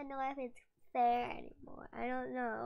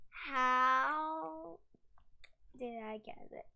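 ordinary talk, nothing marked

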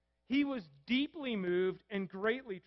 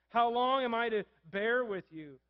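louder exasperated repeat, pressing on How; the second How long thrown out louder, fed up; frustrated Jesus; 3 semitones higher, 7 dB louder than usual. Acted. Jesus venting at the faithless generation, louder exasperated repeat. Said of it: "sense the frustration in his voice".

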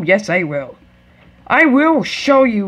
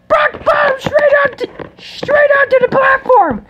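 high, frantic wrestling call; shouting commentator; 4 dB louder than usual. Pretend. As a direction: yell the action as it happens. Shouting play-by-play.

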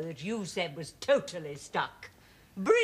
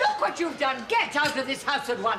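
raised, outraged mother's order; angry scolding; 3 semitones higher, 4 dB louder than usual. Acted. Mother to son who spilt the walnuts, angry scolding. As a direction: scold him and throw him out.

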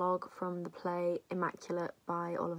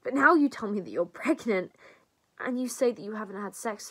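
loud, raised-pitch accusation; accusing; 3 semitones higher, 7 dB louder than usual. Acted. Confronting her best friend about the pregnancy, accusing.

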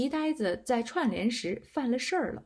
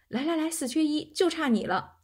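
higher-pitched, eager invitation; lightly pressing, raised pitch; eager beckoning; 6 semitones higher than usual. A narrator's reading. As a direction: beckon someone over to join the game. Classmate recruiting a card player.

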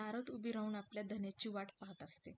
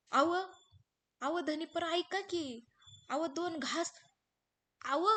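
high, loud pleading calls; pleading call after husband; 6 semitones higher, 6 dB louder, slower than usual. Acted. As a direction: call him back, begging. पत्नी जाणाऱ्या पतीला हाक मारते. (जाणाऱ्या धन्याला आर्जवाने मोठ्याने हाक)

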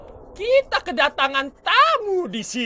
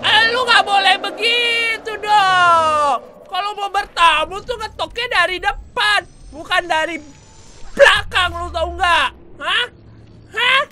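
higher, louder, slow chiding; mock scolding; 10 semitones higher, 6 dB louder than usual. Real, not acted. Gamer scolding a Leviathan that sneaked up behind, loud mock scolding. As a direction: scold the monster like a rude guest.

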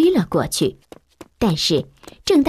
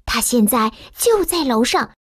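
higher, urgent maid warning; worried servant; 6 semitones higher than usual. A narrator's reading. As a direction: warn the boy about the giant. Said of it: "佣人警告说". (着急的女佣警告)

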